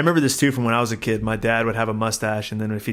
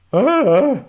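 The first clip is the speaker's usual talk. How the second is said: high startled "Ah" at the X-ray; a sudden high yelp of alarm; shocked gasp; 13 semitones higher, 7 dB louder than usual. Retold or imitated. Patient seeing his broken collarbone, high startled cry. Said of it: "and I'm like".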